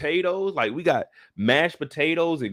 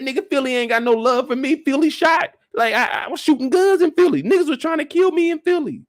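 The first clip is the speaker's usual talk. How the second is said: louder, higher outburst; heated refusal; 7 semitones higher, 4 dB louder than usual. Retold or imitated. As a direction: push back hard at a friend.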